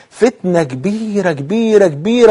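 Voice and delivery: "كبيره" stretched long each time, pitched high; emphatic warning. Real, not acted. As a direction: draw out the long vowel in every "كبيره". Warns of the greatest trial.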